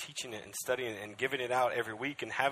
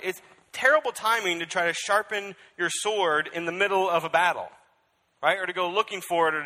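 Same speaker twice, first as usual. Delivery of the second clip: loud, higher sermon voice; full-force preaching; 8 semitones higher, 12 dB louder than usual. Acted. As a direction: drive it home to the crowd.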